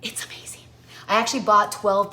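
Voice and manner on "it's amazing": breathy whispered aside. Real, not acted. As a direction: whisper it in awe. Whispered tasting remark.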